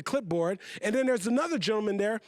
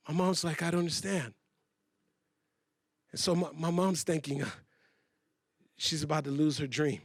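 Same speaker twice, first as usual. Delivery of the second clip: dejected; 6 semitones lower, 4 dB quieter than usual. Retold or imitated. Retelling mother's dejection.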